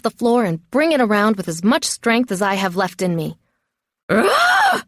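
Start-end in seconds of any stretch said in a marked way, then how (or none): 4.1-4.8 very high-pitched scream; effortful scream. A narrator's reading. Captive swinging the stick at her attacker, effortful scream. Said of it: "I scream".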